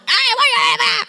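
mock angry mum shouting; angry mother shout. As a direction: snap at the kids on coming home. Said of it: "you shout".

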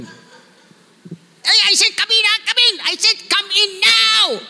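high-pitched scolding mock yell; parent shouting. Parent ordering children to come in now, shouting. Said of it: "mothers shouting".

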